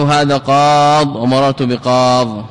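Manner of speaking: قاض slowly, long ā, stopped on ḍ; final ي dropped. Shown as a demonstration. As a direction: stretch قاض and end on the consonant. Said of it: "في المنون الحذف".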